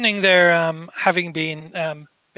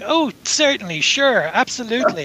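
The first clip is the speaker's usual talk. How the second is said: higher-pitched, airy brush-off; courteous but dismissive, pitched up; politely dismissive; 5 semitones higher than usual. Acted. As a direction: agree politely while brushing them off. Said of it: "very, you know, politely, dismissively going".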